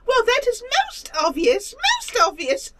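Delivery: lofty squeaky creature; prissy high monster drawling. Acted. GM voices creature character.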